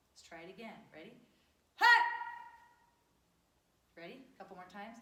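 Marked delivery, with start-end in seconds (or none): 1.8-2.0 short explosive martial arts shout; kiai shout. Shown as a demonstration. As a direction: belt it from the gut. Karate drill, shouted kiai.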